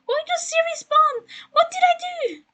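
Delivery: very high, loud, flustered wail; frantic, high-pitched complaint; exasperated squealing. Real, not acted. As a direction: squeal in disbelief at an intruding assistant. Flustered outburst at Siri popping up.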